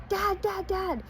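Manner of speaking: put-on high, excited call of a small boy; calling out for dad. Little Steve calling his dad over to the snake, high excited call. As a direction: yell for your dad across the bush, mock-kid.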